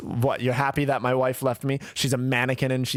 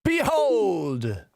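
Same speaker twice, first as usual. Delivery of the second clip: mock narrator; 8 semitones higher than usual. Echoing the narrator's grand word.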